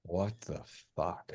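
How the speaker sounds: slow, hushed disbelief; stunned. Acted. Detective sees the mangled body, slow hushed shock. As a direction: breathe it out, stunned by the sight.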